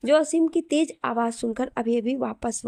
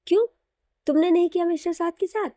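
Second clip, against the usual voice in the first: sharp challenge; 6 semitones higher, 3 dB louder, faster than usual. A narrator's reading. Aseem challenges Natasha.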